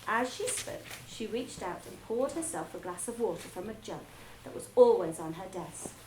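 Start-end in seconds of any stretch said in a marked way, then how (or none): none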